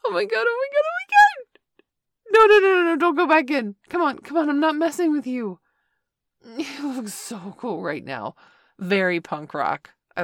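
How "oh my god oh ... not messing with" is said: excited squeal. Acted. Shocked delight at outfit reveal.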